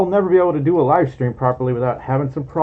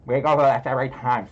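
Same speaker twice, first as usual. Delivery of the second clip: fast, lower-pitched delivery; quick repeat; 3 semitones lower, faster than usual. Shown as a demonstration. Streamer repeating the line to show the jaw, quick and low. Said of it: "if I want the jaw to move".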